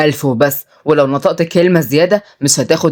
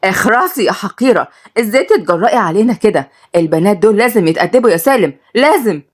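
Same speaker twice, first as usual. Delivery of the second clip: furious; 7 semitones higher than usual. A narrator's reading. Furious mother silencing the defiant girl. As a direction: spit out the insult in rage. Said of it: "اتعصبت جدًا".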